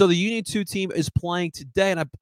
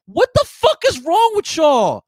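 loud, high, slow angry yell; furious shout; 13 semitones higher, 10 dB louder, slower than usual. Real, not acted. Scolding his timid fanbase, a loud furious yell. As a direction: blow up at the fans. Ranting at scared fans.

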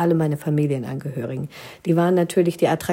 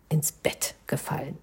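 tired whisper; 4 semitones lower, 8 dB quieter than usual. Acted. Falling into bed tired.

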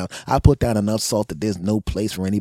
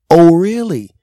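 loud, high indignant "oh really"; offended homeowner snapping back at kids; mock indignant; 10 semitones higher, 13 dB louder, slower than usual. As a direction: bristle at the insult.

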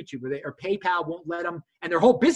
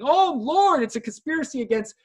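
mock outcry; 8 semitones higher than usual. Imitating banned creators complaining of a conspiracy, mock outcry. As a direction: play up the outraged lament. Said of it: "are yelling and screaming, saying".

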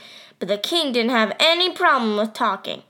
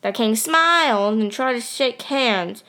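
ordinary delivery

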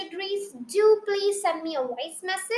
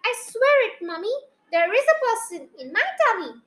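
raised, earnest protest; insistent cry; 6 semitones higher, 3 dB louder, faster than usual. A narrator's reading. Child swearing to Mummy, insistent cry. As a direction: plead to be believed, pitch lifted. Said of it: "I cried".

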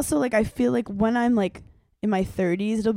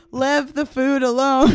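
mock Andrew; 5 semitones higher, 5 dB louder, slower than usual. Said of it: "Andrew wanted to say".

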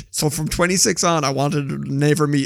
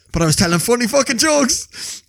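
excited boast; 9 semitones higher, 5 dB louder than usual. Retold or imitated. Retelling excited dream boast.

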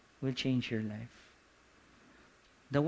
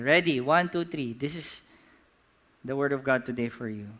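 usual speaking voice

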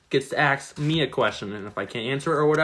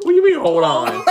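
high laughing outburst; 5 dB louder than usual. Real, not acted. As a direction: burst out laughing loudly. Host cracks up at the delay.

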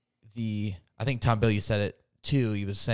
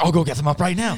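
eager husband; 6 semitones higher, 7 dB louder, faster than usual. Retold or imitated.

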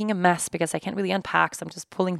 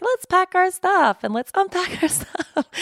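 imitating an eager travel lover, high and drawn out; high, slow, excited mock cheer; gleeful eagerness; 11 semitones higher, 3 dB louder than usual. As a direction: mimic someone loving every minute, pitched way up with a laugh. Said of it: "so loving it all the time".

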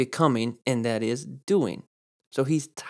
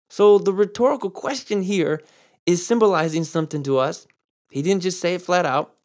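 ordinary delivery

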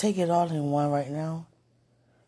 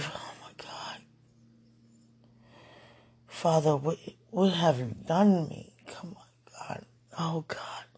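overwhelmed whisper. Real, not acted. Pleading with God, overwhelmed, in a whisper. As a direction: whisper your plea, barely any voice.

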